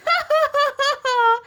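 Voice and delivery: high, loud mocking laugh; hooting laughter. A narrator's reading. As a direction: hoot like a crowd of little monsters. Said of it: "hooted with laughter".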